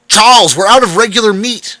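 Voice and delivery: high, loud shout across the kitchen. A narrator's reading. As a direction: yell the bad news.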